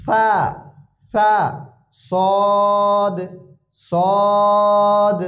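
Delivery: সিন thin and light, সদ thick and full; drilled Arabic letters. Shown as a demonstration. Teacher drills three similar letters for students, slow and exact. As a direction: contrast সিন, সা and সদ with slow held letter names. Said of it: "পাতলা হবে".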